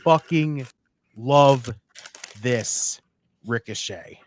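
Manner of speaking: spaced-out, savored declaration; emphatic, word by word. Real, not acted. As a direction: leave a pause after each word for weight. Gushing about Ricochet, word by word.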